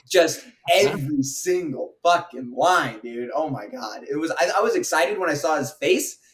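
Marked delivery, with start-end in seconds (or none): none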